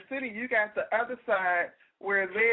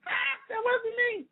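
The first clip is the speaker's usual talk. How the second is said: much higher, slow mock crying shout; mock wail; 12 semitones higher, slower than usual. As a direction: wail it like a hammed-up protest. Repeat of denial, much higher.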